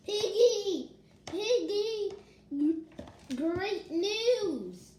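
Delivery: high, loud, thrilled shout of the name; excited calling out. Acted. Reading to her viewers, she calls out to Piggy with excitement. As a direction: call out the good news like you can't wait.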